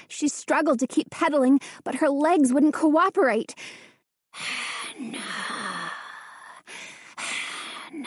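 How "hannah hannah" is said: unvoiced ghostly whisper, drawn out; dry whisper. A narrator's reading. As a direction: breathe the name like a haunting shadow. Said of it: "The dry whisper seemed to circle her".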